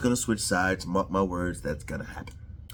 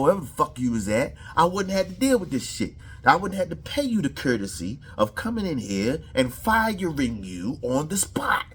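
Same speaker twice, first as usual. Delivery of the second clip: heated Rhodes; 7 semitones higher than usual. Retold or imitated. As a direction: fire back at him hard. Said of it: "he came right back with the heat".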